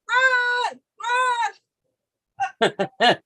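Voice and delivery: a high yelled "Run" that drops on the second one; retold yell. Laughing friend recalls yelling as the store alarm rings. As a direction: sound a mock alarm. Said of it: "and we would yell".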